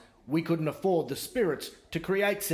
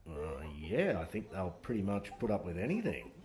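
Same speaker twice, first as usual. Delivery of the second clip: lower, softer smug official; smug politician; 8 semitones lower, 7 dB quieter than usual. Retold or imitated. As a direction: answer lazily, sure of getting away with it. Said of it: "they're like".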